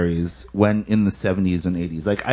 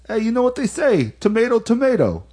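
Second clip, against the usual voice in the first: New York pizza guy; 13 semitones higher than usual. Retold or imitated. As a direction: play the know-it-all pizzeria guy, pitched up. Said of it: "And the one guy goes".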